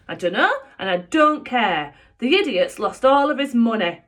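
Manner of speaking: loud, scornful retort; snappy stepmother. Acted. Sapphire scorning Joe's dad as she leaves, loud and scornful. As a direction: brush him off loudly.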